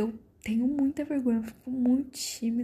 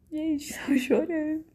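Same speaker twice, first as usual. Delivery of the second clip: slow, tearful half-laugh; choked-up giggle, drawn out; laughing while crying; 4 semitones higher, slower than usual. Retold or imitated. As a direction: laugh through the tears, slowly. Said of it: "eu chorando, só falei assim".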